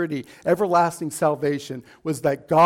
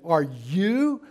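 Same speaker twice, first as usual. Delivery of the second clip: heavy emphasis on YOU; emphatic YOU; 3 semitones lower than usual. Retold or imitated. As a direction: stretch out YOU with a questioning rise. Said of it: "that word you, emphatic".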